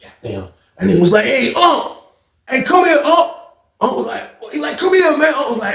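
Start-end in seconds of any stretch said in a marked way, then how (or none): 1.3-3.3 high-pitched call to someone down below; retold call to come over. Calling the older man over, acted. 4.8-5.3 high, urgent summons; retold high-pitched holler; calling out. Calling the older man over, acted.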